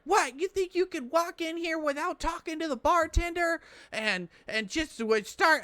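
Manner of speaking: mock outraged bartender, high-pitched rant; raging bartender. Bartender tearing into a customer who walked past her, high mock rage. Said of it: "comes over in a rage".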